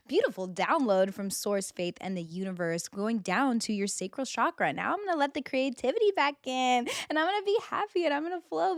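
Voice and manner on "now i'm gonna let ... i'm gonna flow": high, lilting happy self-talk; upbeat inner voice. Acted. Voicing her own flowing creativity, high and upbeat. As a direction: lift it high, like a happy affirmation.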